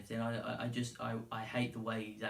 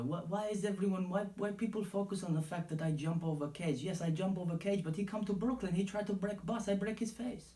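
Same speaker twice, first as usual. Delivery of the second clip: high-pitched mock Dagestani accent in broken English; Khabib impression; 8 semitones higher than usual. Podcaster mimicking Khabib's threat to Conor, hammed-up high Dagestani accent. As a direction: ham up a Dagestani fighter's accent, pitched well up. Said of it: "he was saying at one point".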